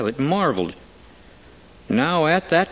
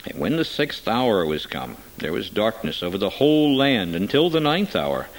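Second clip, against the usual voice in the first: ordinary delivery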